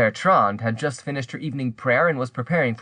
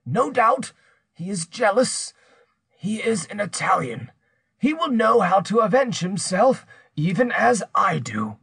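higher-pitched, menacing accuser's voice; threatening accuser; 8 semitones higher than usual. Acted. Pierre threatens to publish her secret, menacing. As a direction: press the threat, drawing out the ruin.

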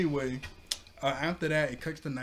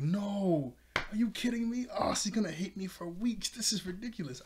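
imitating Oric panicking; 7 semitones higher than usual.

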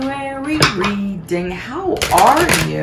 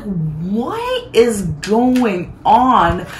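mock disbelief; 3 semitones higher, much slower than usual. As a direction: stretch every word in mock shock. Acted disbelief at his sign.

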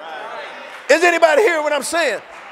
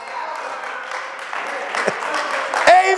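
breathy laugh; 3 semitones higher, 3 dB quieter than usual. Real, not acted. Laughs mid-sermon.

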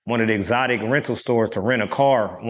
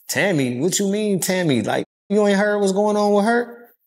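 surprised; 7 semitones higher than usual. Retold or imitated. Retold surprised reaction.

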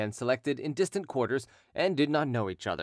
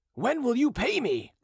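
high, louder angry demand for payment; 12 semitones higher, 4 dB louder than usual. Acted. Creditor confronting Derues in the street, angry high demand. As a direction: demand your money, loud and high. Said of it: "demanded the creditor".

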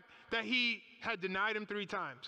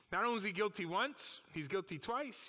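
normal talking voice

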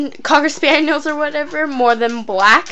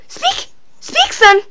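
squeaky dog command; slower than usual. Real, not acted.

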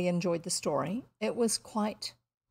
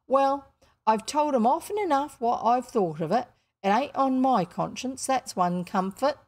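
blunt working-class housekeeper, lively rise and fall; emphatic working woman's voice with dropped letters; working-class Ellen; 4 semitones higher, 6 dB louder than usual. Acted. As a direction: scold and advise with unrefined vowels. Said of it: "she doesn't have refined vowels".

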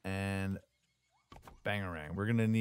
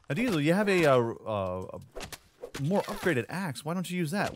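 imagined viewer's question; 6 semitones higher, 3 dB louder than usual. Acted. Voices a viewer questioning his axe.

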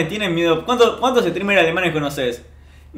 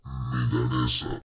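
very low, slow, softer milanesa; deep gag voice; 10 dB quieter, slower than usual. Pretend. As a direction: drop into a silly deep voice. (milanesa con voz gravísima y lenta)

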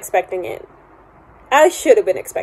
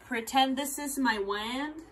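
ordinary talk, nothing marked

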